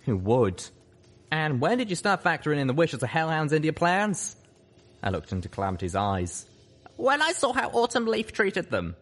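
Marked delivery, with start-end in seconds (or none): 1.3-4.4 higher drawling voice; Calamity's voice. Acted. 6.9-8.9 put-on young filly voice. Lil Pip.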